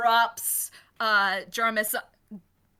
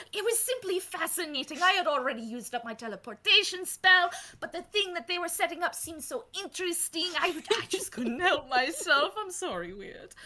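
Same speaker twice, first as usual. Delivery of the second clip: put-on Doctor Boffin's butchered accent, higher; eager professor in a badly faked accent; 7 semitones higher than usual. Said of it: "I know I'm butchering his accent".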